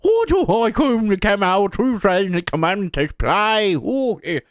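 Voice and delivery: high put-on Irish lilt; mock Irish leprechaun accent. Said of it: "everybody sounds like a leprechaun over there".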